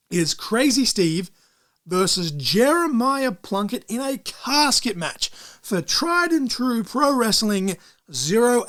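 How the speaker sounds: slow, drawn-out ring announcer read. Acted. Announcing match of the week.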